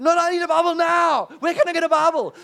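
high, loud insistent demand; insistent shout. Retold or imitated. Champion shouting at the preacher for a Bible now. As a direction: refuse to wait, shout it out. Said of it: "And he's like".

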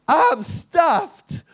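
high, loud panicked cry of being doomed; panicked shout. Retold or imitated. Champion shouting to the American in panic. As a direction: shout it like you just realised you're in trouble.

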